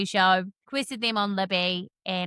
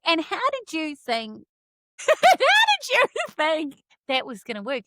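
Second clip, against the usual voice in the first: high, louder laughing question; laughing disbelief; 6 semitones higher, 5 dB louder than usual. Retold or imitated. Asking her cohost how the plan would work, laughing. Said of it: "I was like".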